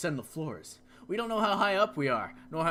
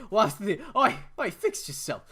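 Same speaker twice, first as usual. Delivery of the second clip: ordinary talk, nothing marked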